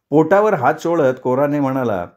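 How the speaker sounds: usual speaking voice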